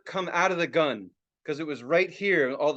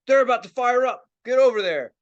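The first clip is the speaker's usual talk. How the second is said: raised, higher-pitched urging; urgent holler; 6 semitones higher, 6 dB louder than usual. Retold or imitated. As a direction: holler like a cousin hurrying someone along. Cousin urgently sends him over.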